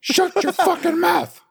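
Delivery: very high, loud squeaky shout; Squirrely Dan quote. Retold or imitated. Friend suggests quoting Squirrely Dan back, squeaky shout. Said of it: "you should quote Squirrely Dan".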